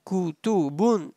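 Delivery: Kutubun softly, as one connected word; joined up. Shown as a demonstration. Teacher joining the sounds for students, joined up. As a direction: link ku, tu, bun into Kutubun. Teacher spells out, then joins.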